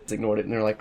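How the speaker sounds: normal talking voice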